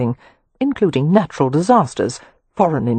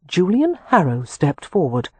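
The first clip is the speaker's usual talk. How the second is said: ordinary delivery, nothing marked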